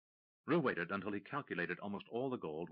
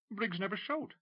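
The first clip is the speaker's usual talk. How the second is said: nervous McCracken; 12 semitones higher, 3 dB louder than usual. Acted. Nervous McCracken answering Tim.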